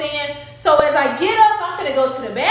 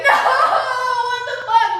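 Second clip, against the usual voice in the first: crying out; 9 semitones higher than usual. Retold or imitated. Pain, rolled on back.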